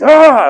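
loud high-pitched "ah" yell; retold angry yell. Man furiously flushing the dead insect, angry yell. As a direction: let out a mad battle yell. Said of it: "I'm, like, yelling out as I flush it".

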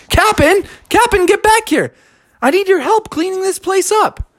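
put-on high, urgent kid calling; Froggy. Boy begging the captain to return, urgent. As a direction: call after him in panic. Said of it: "And Froggy said".

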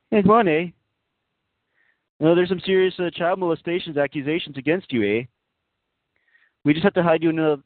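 put-on high-pitched hoser Canadian accent; hammed-up Canadian accent, pitched well up; Canadian church official. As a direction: cajole like a chummy Canadian, ending in "eh?". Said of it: "You guys wanna want a Canadian pope?".

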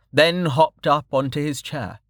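plain reading voice

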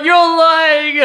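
high, loud wailed accusation, half laughing; acted delirium. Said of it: "yeah, delirious".

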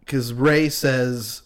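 ordinary talk

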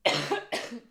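short dry cough to clear the throat. Real, not acted. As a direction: cough once, throat tickling. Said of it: "Je m'excuse, j'ai un, j'ai un chat dans la gorge". (toux sèche)

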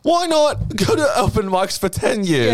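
high, slowed sarcastic jab; sarcastic high-pitched mockery. Mocking career advice sarcastically.

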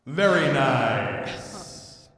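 drawn-out, loud, mocking "Very nice"; mock savoring. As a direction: stretch it way out and ham up the praise.

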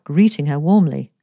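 usual speaking voice

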